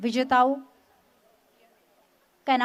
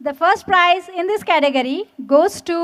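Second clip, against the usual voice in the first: booming prize announcer; 4 semitones higher, much slower than usual. Real, not acted.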